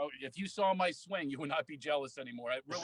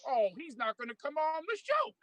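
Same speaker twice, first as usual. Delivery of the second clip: Magic Johnson impression; 9 semitones higher than usual. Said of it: "you go".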